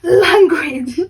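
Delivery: scolding leader. Retold or imitated. Cadet leaders telling off swearing kids, loud stern reprimand.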